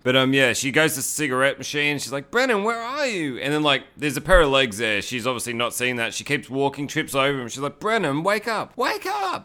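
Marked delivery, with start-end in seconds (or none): 2.3-3.4 high-pitched mock girl voice; girlfriend calling out. Girlfriend looking for her missing boyfriend, high mock calling. 7.8-9.4 high mock girl voice, climbing; girlfriend calling out. Girlfriend trying to wake her boyfriend, rising high-pitched mock pleading.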